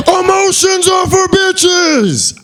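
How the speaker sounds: high, slow, stretched-out yell; hammed-up high scream of a grown man; mock screaming man. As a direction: bellow it like a ranting man, dragged out. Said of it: "grown-ass man screaming at the top of his lungs".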